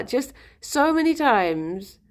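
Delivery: usual speaking voice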